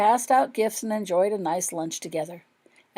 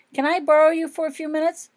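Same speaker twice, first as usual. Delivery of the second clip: a higher, lightly acted boy's voice; lightly voiced James; 10 semitones higher, 7 dB louder than usual. A narrator's reading. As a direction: voice James asking a favour. James asks, voiced character.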